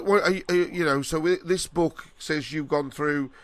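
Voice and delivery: normal talking voice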